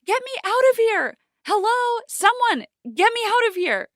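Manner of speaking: very high, loud desperate screaming; screaming for help. A narrator's reading. Trapped girl pounding on the door, screaming. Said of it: "I screamed at the top of my lungs".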